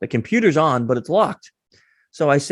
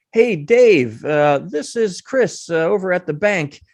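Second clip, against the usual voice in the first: higher, drawn-out casual caller voice; re-enacted phone greeting; 5 semitones higher, slower than usual. Tester phoning an accomplice before the guard, breezy put-on greeting. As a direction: greet Dave in a raised, stretched phone manner.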